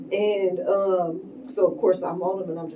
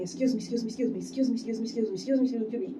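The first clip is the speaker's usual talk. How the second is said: higher, run-together polite repeats; retold hurried apology; 4 semitones higher, 5 dB quieter, much faster than usual. Getting through everybody in the rows, retold hurried apology. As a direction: lightly act out squeezing past people in a hurry.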